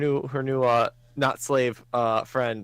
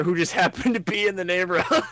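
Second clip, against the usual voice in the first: higher voice breaking into laughter; laughing while talking; 5 semitones higher than usual. Real, not acted. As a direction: tell it through a real laugh. Laughs while telling absurd coincidence.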